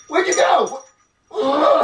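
very high, loud cry; startled kid character. Acted. Junior turns back to find his rat gone, startled. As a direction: blurt it in shrill disbelief.

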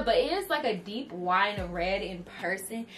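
ordinary talk, nothing marked

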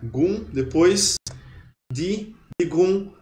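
slow "Gung", then "di", then "di gung"; built up backwards. Shown as a demonstration. Teacher showing students how to pronounce it, slow back-to-front syllables. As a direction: build the word slowly from its last syllable. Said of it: "Aí como que vocês vão pronunciar?".

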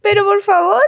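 a light, high, huffy protest; mock indignation. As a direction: play at being offended. Mom's mock indignant reply. (indignación fingida, leve)

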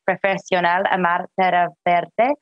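normal talking voice

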